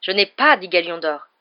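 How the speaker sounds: usual speaking voice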